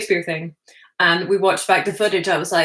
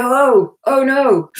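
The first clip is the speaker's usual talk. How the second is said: a light, higher mock "oh no" of dismay; 6 semitones higher, 5 dB louder, much slower than usual. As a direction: act out a small embarrassed wince. Dismay at seeing own mistakes on camera.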